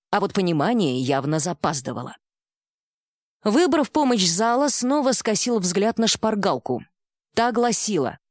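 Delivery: ordinary delivery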